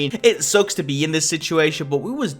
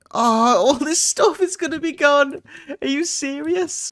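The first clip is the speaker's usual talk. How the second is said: mock wail; 12 semitones higher than usual. Loses all items, wails.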